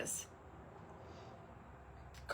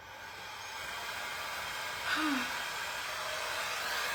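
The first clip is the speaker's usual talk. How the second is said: long breathy sigh that falls away; long exasperated sigh; 3 semitones higher, 6 dB quieter than usual. Real, not acted. Crafter fed up with her missing torch, long sigh. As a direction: blow out all the frustration.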